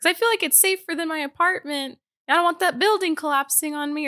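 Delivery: mimicking a woman sheltering in the gym; lady at the gym. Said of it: "She's like".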